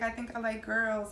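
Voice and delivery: usual speaking voice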